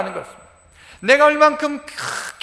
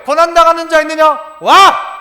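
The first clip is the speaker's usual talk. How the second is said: raised pitch, loud declaration; loud preaching; 4 semitones higher, 7 dB louder than usual. Acted. Preacher answering suffering with a wow, in a loud proclamation. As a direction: proclaim it with triumph.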